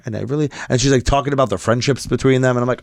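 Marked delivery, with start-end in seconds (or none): none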